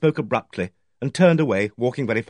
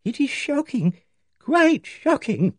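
put-on high, fluttery old lady voice, slow and faltering; timid spinster shocked by talk of murder, trembling; tremulous; 13 semitones higher, slower than usual. A narrator's reading. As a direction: let the words quiver with dismay. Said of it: "Miss Barton said tremulously".

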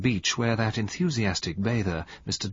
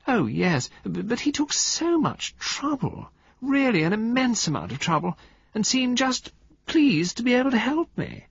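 high, sweet society-lady voice; sweet drawl. Acted. Valentine charming the men on the beach, high sweet drawl. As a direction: coo it like a pampered society lady. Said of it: "sweet, drawling voice".

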